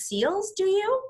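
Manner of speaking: high pitched incredulous question; alarmed question. Acted. Taxi driver asks Mister Popper about the seals, high alarmed question. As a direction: squeak it up high in disbelief.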